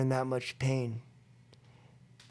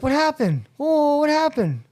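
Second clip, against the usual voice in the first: higher and louder, taken aback; alarmed sponsor; 12 semitones higher, 5 dB louder than usual. Retold or imitated. Sponsor answering the phone, alarmed and louder. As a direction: react startled.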